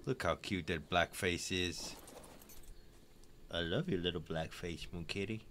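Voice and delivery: soft cooing. Real, not acted.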